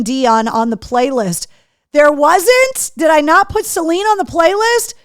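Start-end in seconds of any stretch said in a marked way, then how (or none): 1.9-4.9 high, loud disbelieving question; surprised. Real, not acted. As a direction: caught off guard, really surprised. Realizes song missing from playlist.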